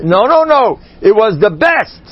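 high, loud insistence; excited reversal. Acted. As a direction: overturn it with a burst. Reversing himself emphatically.

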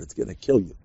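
ordinary talk

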